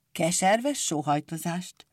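usual speaking voice

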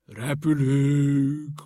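loud, very slow drawn-out cheer; rejoicing as the plane moves; jubilant cry. A narrator's reading. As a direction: whoop with joy. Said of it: "ujjongott Gombóc Artúr".

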